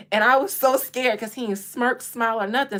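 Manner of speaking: normal talking voice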